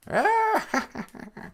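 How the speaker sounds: very high mock witch cackle. Storyteller turning the bike lady into a witch, high imitated cackle.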